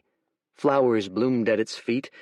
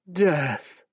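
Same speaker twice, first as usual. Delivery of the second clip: sighed; 5 semitones higher than usual. Acted. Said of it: "sighed the creature".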